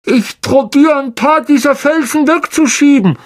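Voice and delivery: put-on high boyish voice, strained; Ron's voice. A narrator's reading. Ron behind the rocks answering Harry: brave boy's voice.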